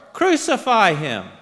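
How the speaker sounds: loud, high mob demand; insistent crowd shout. A narrator's reading. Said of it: "they shouted all the more".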